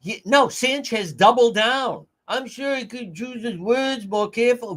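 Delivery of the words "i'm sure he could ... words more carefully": high, sing-song mocking accent; mock Charlene Lima accent. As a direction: mimic her accent high, without shouting. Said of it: "Why don't you learn English?".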